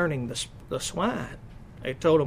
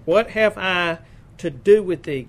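moderately raised, louder scripture quote; loud cry; 3 semitones higher, 7 dB louder than usual. A narrator's reading. Unclean spirit cries to Jesus in the reading, moderately loud cry. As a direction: lift the voice a little for the quoted cry. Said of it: "cried with a loud voice".

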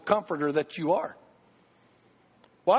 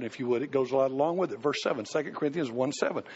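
usual speaking voice

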